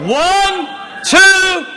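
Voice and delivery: high, loud crowd cue; rousing full-voice shout; shouted countdown. Real, not acted. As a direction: whoop them into jumping. Shouted countdown for congregation to leap.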